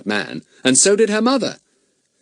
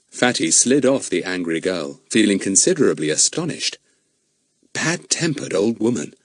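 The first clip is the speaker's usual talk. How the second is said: plain reading voice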